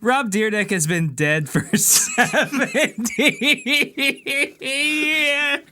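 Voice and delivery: cracking up mid-line; giggling through words; laughing. Real, not acted. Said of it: "Let me take that again".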